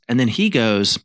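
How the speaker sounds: normal talking voice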